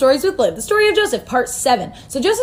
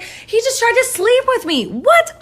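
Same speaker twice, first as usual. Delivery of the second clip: mock outrage; 12 semitones higher, slower than usual. Wife accuses, husband shocked.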